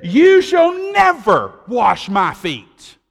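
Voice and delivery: emphatic refusal. Acted. Peter's refusal voiced.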